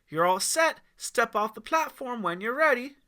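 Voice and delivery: high, loud, friendly call; female guide voice. Acted. Guide calling the boy to the zip line, friendly. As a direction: call him up cheerfully. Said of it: "She laughed".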